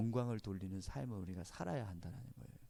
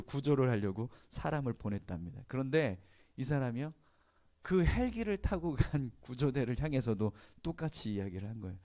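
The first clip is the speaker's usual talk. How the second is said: ordinary talk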